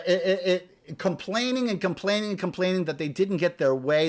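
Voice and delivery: usual speaking voice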